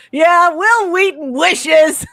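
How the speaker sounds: high, loud, drawn-out mocking shout; heckling yell. Wife heckling to make her husband laugh, playful loud yell. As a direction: yell it out like a jeer at the screen. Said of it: "I yell out".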